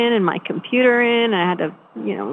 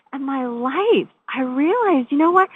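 ordinary talk

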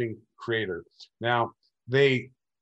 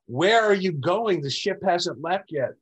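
raised, higher-pitched imitation of a shout; retold shout; 7 semitones higher, 3 dB louder than usual. Companion calls after the man leaving the spaceship, mock shout. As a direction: call it out, mimicking a shouting companion. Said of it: "Michael Allegiant shouted".

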